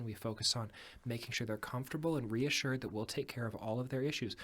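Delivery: ordinary talk, nothing marked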